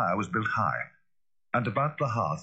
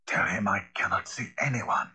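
voice from within. A narrator's reading. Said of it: "A voice answered from within".

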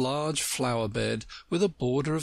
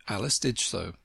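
ordinary delivery

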